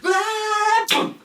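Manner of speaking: very high, loud squeal; second brother yelping as he is thrown out; panicked yelp. Acted. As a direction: cry out while being flung away.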